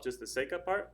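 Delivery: ordinary talk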